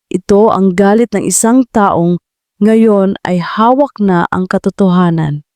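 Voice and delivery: ordinary talk, nothing marked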